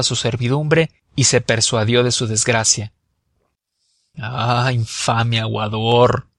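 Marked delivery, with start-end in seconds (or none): 4.2-6.3 put-on slow, drawn-out curse in Auvergnat accent; lightly acted Auvergnat outrage; exclaiming, Auvergnat accent. A narrator's reading. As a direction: curse him slowly, with an Auvergnat tongue.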